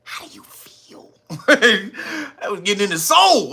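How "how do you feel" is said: fading to a soft hushed whisper; taunting whisper. Retold or imitated. Comedian taunting the mayor, fading hushed whisper.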